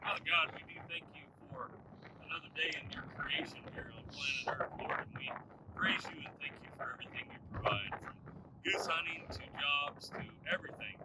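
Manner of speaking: hushed, reverent prayer; quiet prayer. Acted. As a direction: pray softly to the Lord. Leads a prayer before hunt.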